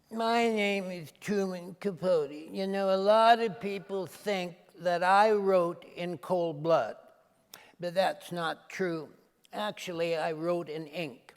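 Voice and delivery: drawn-out, fey Truman Capote impression. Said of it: "when I do Truman Capote".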